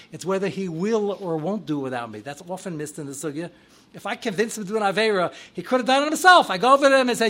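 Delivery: normal talking voice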